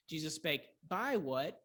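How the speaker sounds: BY punched, what lighter; stress on BY. Shown as a demonstration. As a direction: hit the preposition by. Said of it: "need to find another preposition".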